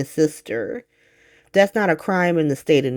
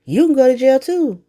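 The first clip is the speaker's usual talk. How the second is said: put-on higher, taunting old-man sneer; mock the uncle with a raised, jeering voice; mocking Drew voice; 8 semitones higher than usual. Recapping Drew threatening the kid, a higher mocking voice. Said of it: "Drew is like".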